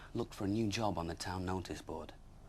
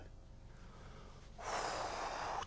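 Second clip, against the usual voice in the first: soft breathy relieved exhale; long sigh of relief; 4 dB quieter than usual. Acted. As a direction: let out a big relieved sigh. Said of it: "Tom heaved a sigh of relief".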